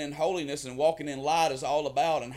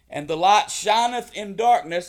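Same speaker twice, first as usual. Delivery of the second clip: booming biblical narration; 4 semitones higher, 8 dB louder than usual. Acted. Reads John chapter one.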